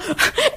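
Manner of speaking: a short high giggle, breathy and soft; high quiet giggle. Real, not acted. Comedian laughing at her own joke, high quiet giggle. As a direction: let a little giggle slip out.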